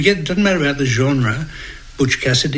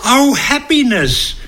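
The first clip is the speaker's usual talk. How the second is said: groom's reply; 11 semitones higher, 4 dB louder, slower than usual. Retold or imitated. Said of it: "and he goes".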